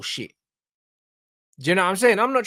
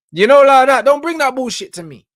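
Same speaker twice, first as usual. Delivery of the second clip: loud outburst; 6 semitones higher, 9 dB louder than usual. Real, not acted.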